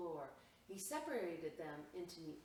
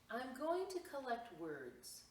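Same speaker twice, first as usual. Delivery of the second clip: eager announcement; 7 semitones higher, 3 dB louder than usual. Acted. Max announcing his plan.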